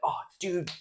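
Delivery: playing his own overexcited plans; hyped-up self quote. Telling viewers his day-off plans, mock hyped voice. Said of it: "I'm like".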